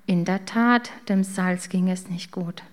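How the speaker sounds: plain reading voice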